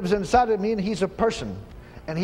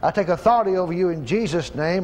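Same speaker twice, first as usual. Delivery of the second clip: normal talking voice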